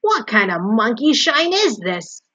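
loud, slow, indignant growl. A narrator's reading. Rat surprised out of sleep by Wilbur, growling. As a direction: growl it out, affronted. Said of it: "he growled".

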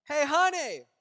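imitated wife calling out, high and loud; yelling out. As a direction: call across the house. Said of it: "my wife goes and yells out".

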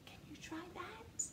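whispering invitation to join in. Real, not acted. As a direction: drop to a whisper.

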